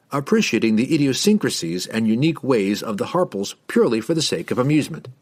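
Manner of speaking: ordinary delivery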